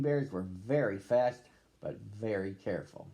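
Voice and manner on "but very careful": ordinary delivery, nothing marked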